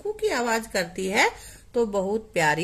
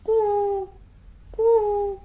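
high coo, coo, growing louder; cuckoo imitation; 9 semitones higher, 4 dB louder, much slower than usual. Shown as a demonstration. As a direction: copy the cuckoo for the class. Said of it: "कोयल की आवाज की नकल करे". (कोयल की नकल, ऊँची कूक)

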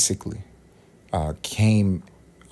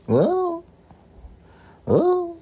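high drawn-out well. Acted. Long high held "well.

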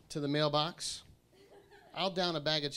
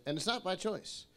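ordinary talk, nothing marked

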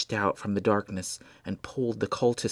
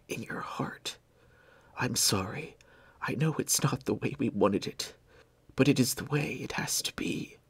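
dying whisper. Acted. Dying Blair to Benjamin, a weak tender farewell whisper. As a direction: let the words fade as life ebbs.

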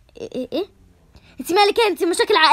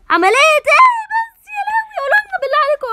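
shocked wail; 13 semitones higher, 4 dB louder than usual. Acted. Mother wailing on hearing of surgery. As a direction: break into alarmed crying.

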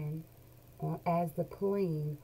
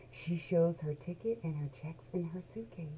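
ordinary delivery, nothing marked

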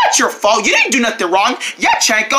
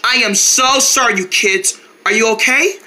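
ordinary talk, nothing marked

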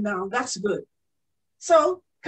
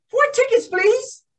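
put-on eager child asking; 9 semitones higher, 4 dB louder than usual. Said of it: "I said".

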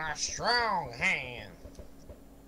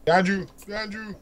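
urgent warning; 5 semitones higher, 6 dB louder, much faster than usual. Real, not acted. Warning teammate.

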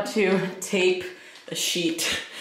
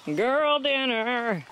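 playful drawl; 8 semitones higher, much slower than usual. Pretend. Jokey slow tag line.

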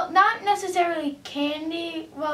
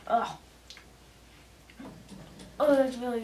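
talking while chewing food; disgusted, food in mouth; mouth full, chewing; 3 semitones lower, faster than usual. Real, not acted. Seen 1: Peanut raised to mouth just before; jaw moves, disgusted faces throughout.

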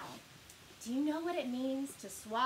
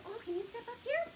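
ordinary delivery

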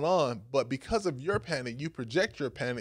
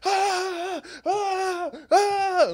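high, loud cartoon wails; panicked cartoon screams; 4 dB louder, much slower than usual. Disciples running round the boat, wailing high in cartoon panic. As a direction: scream like SpongeBob running in circles.

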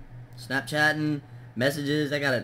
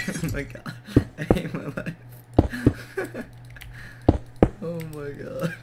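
giggling through the words; real laughter, higher and breathier; laughing at herself; 5 semitones higher, 7 dB louder than usual. Real, not acted. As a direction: laugh it off, embarrassed at yourself. Laughing at her own mistake.

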